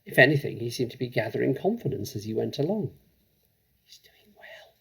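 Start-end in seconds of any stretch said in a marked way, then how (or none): 3.8-4.7 soft unvoiced whisper. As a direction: breathe it secretly to a friend. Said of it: "she whispered to Lavender".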